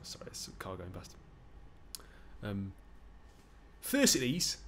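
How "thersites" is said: big strong Geordie, calling out high; Geordie accent. Acted. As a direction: call sharply in a Geordie accent. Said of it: "give him a Geordie accent".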